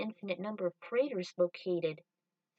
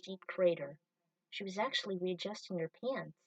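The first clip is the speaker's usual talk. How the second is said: usual speaking voice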